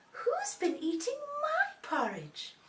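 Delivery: high, slow Mummy bear; mummy's voice. Shown as a demonstration. Mummy bear asks about her porridge, high mummy voice. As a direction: draw it out high as a mother bear. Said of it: "in a mummy's voice".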